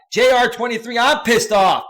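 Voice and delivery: loud, high mock outburst; hyped read-out. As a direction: call out the comment at full voice. Hyped read-out of chat comments.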